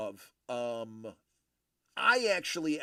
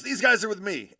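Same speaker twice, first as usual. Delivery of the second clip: loud, higher, proud claim; loud declaration; 6 semitones higher, 11 dB louder than usual. Retold or imitated. Senior chief vouching for sailors to the deck officer, loud. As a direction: announce it loudly like the boss covering his men. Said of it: "just goes".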